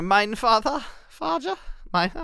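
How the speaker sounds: high, hammed-up German accent; mock German. Podcast host joking he should host this way, mock German. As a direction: put on a coy, high-pitched German voice. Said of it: "that's my German".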